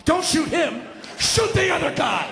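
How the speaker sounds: high, excited shouted instructions; urgent yelling. Retold or imitated. Caller telling cops about his friend, urgently yelled. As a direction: escalate into a high comic holler.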